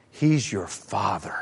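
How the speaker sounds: soft and slow, He's your Father; tender. Acted. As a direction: say it gently like a promise. Gentle key line.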